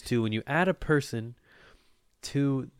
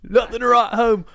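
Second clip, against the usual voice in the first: put-on hillbilly accent; 12 semitones higher, 11 dB louder than usual. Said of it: "I don't know what this goddamn accent is".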